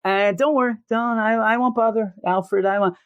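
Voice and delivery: high, airy, oblivious promise; high airy reassurance; dazed Kirk voice. Retold or imitated. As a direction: promise nothing while drifting off. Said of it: "He keeps saying it".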